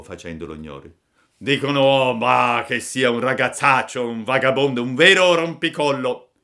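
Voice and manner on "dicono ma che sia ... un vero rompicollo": put-on loud, raised old-man voice; disapproving old man. A narrator's reading. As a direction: pass on bad gossip as an old villager. (vecchietto che disapprova, voce forte)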